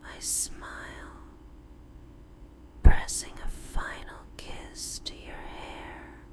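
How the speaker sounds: ordinary delivery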